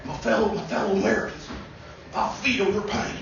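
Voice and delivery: soft, husky Southern Clinton; Clinton impression. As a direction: breathe it out like Bill Clinton. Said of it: "Back to Clinton".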